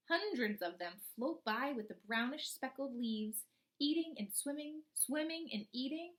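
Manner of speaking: put-on slow, expressive read-aloud for young children; storybook reading. Teacher reading a frog book to her class, storybook voice.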